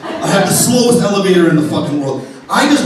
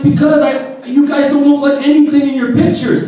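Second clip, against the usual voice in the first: mock indignant self; 7 semitones higher than usual. Telling off fake-photo dates.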